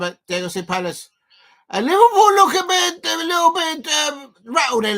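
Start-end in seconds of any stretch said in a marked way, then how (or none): 1.8-5.0 much higher and louder call; excited commentary. Real, not acted. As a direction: lift the voice as play heats up. Live reaction to the play.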